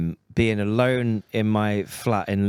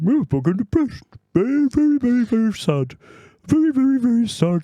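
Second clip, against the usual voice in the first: high, louder mock sobbing sing-song; silly sad-man voice; 12 semitones higher, 3 dB louder than usual. Mocking his own sadness on the podcast, high silly wail. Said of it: "I was laid there going".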